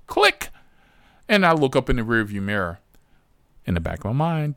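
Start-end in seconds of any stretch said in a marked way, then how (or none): 0.1-0.5 a sharp high click, mimicking a hang-up; high clicking phone sound. Imitated phone hanging up.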